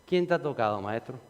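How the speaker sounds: a lightly voiced incredulous disciple; Peter's baffled reply. Peter answers the master about the crowd, low and soft. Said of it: "y dice Pedro".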